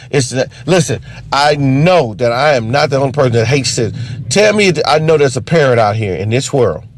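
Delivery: ordinary talk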